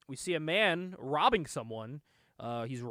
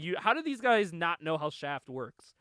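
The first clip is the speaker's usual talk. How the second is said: normal talking voice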